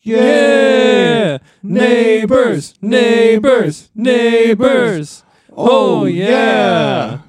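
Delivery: loud high cheering shout. Acted. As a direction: shout it out in joy. Everyone cheers the truce.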